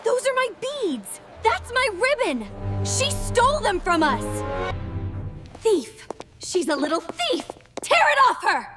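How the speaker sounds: high, shrill angry accusations; furious shrieking. Acted. Stepsisters attack over dress.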